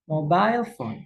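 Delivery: mobile phone with mo stressed and the rest falling. Shown as a demonstration. Teacher shows stress on the first word's first syllable. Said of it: "Kata mo-nya yang diberi tekanan".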